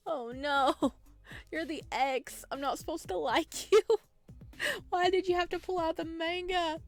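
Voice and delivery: usual speaking voice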